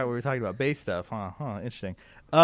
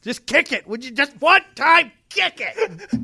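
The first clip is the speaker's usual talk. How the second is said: higher, louder, slow exasperated bit; 11 semitones higher, 6 dB louder, slower than usual. Retold or imitated. Quoting a comedy bit to friends, slow mock exasperation. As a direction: plead it slow like the movie scene. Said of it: "It's Tenacious D when he".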